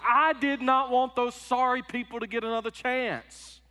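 slow, loud, high indignant Jonah; voicing Jonah. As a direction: spit out the complaint as a resentful prophet. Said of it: "he said".

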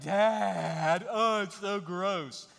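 loud, drawn-out mock disgust; grossed-out kids. Children to dad holding Cindy's hand, grossed out. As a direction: imitate children whining at their dad. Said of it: "they would always like".

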